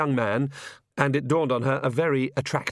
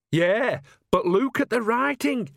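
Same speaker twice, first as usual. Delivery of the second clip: put-on high-pitched old lady's ominous relish; dark relish; 12 semitones higher, 3 dB louder, slower than usual. A narrator's reading. Nanny showing Agnes the carriage crest, dark relish. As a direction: relish the nasty news, pitched right up. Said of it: "with that dark relish".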